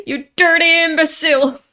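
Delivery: loud, high acted outburst; crying, angry insult. Defeated rival crying as he insults his opponent, loud high yell. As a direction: yell the insult through mock tears. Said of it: "He's crying".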